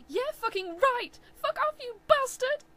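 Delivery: very high, loud screaming curse; furious scream. Acted. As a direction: scream him off. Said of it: "I screamed".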